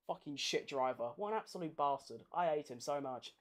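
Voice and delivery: low, quiet venom; seething. Real, not acted. Fan cursing the driver who crashed into Perez, low seething anger. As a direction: mutter your contempt through the teeth. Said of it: "I need to calm down".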